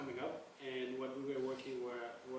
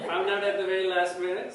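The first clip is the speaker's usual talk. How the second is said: laughing, much louder; 5 semitones higher, 13 dB louder than usual. Real, not acted. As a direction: laugh out the late discovery. Laughing at the Portland mix-up.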